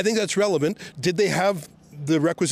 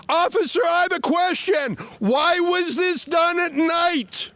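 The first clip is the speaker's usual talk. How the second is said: shouting at officer; 9 semitones higher than usual. Real, not acted. Shouting questions at police.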